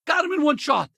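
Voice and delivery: elated battle cheer; triumphant whoop. Acted. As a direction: crow over a perfect shot. Celebrates a kill in combat.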